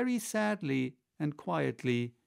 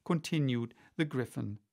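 plain reading voice

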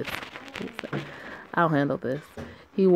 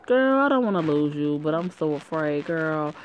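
normal talking voice

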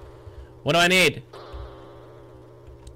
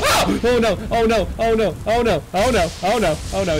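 gasp, then rapid repeated cries; panicked cry. Real, not acted. Player reacts to the ghost appearing, repeated panicked cries. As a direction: blurt it over and over in alarm.

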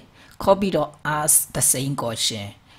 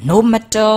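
"No matter" said louder and higher; stressed phrase; 9 semitones higher, 9 dB louder, much slower than usual. Shown as a demonstration. Teacher highlights "No matter" for students, loud and high. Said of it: "ဒါက clause".

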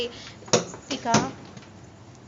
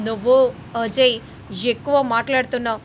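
loud stern warning; angry warning to Ajay; stern rebuke; 6 dB louder than usual. A narrator's reading. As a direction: put him in his place. Counter-scolding Ajay.

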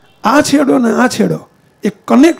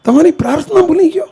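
plaintive higher-pitched cry of remorse, imitated; Gandhi; 7 semitones higher than usual. Gandhi confesses to God he forgot prayer, in acted anguished lament. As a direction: lament upward in pitch, acting out sorrow without sobbing. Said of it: "એ દુઃખમાં મને રુદન આવ્યું કે".

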